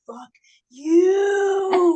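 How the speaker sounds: high shouted curse at the sky; retold outburst, loud and high; mock furious shout. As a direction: yell it up at him, half laughing. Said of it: "and I'm like".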